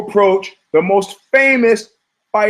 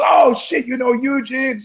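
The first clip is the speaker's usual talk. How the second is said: mock surprise; 8 semitones higher than usual. Kevin surprised in retold story.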